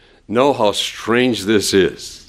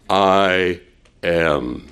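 low, solemn divine voice; lightly voiced God speaking from the bush; 5 semitones lower than usual. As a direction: answer as God, grave and low. God answers Moses.

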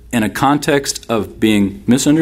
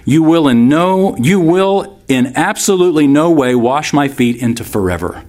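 ABSOLUTELY pushed higher and harder; forceful, raised-pitch insistence on NO; emphatic refusal; 5 semitones higher than usual. Acted. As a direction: hammer out NO and ABSOLUTELY. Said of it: "couldn't have made it more emphatic".

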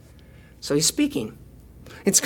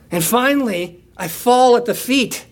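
ordinary talk

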